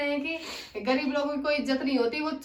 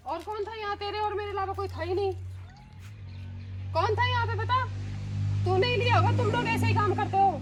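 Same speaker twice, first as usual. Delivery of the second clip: higher-pitched, sharp scolding; angry accusation; 4 semitones higher than usual. Acted. Employer accusing her maid of theft, angrily. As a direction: press the accusation harder as it goes.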